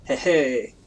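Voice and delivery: loud, slow fake French accent; drawn-out, hammed-up French accent; bad French accent. Gamer asking for butter at table, bad French accent. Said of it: "I can't do a French accent".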